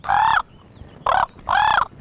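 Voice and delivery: three loud, high caws; excited cawing back. Retold or imitated. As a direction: echo the excited crow caw for caw. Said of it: "I said".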